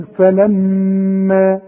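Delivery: فلما slow, with a long nasal hum on م; held ghunnah. Shown as a demonstration. Teacher shows the shadda rule, فلما with long nasal م. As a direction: hum the doubled meem in فلما for two counts. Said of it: "الميم اللي عليها الشدة نقول".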